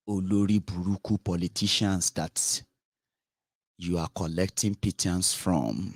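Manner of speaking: ordinary talk, nothing marked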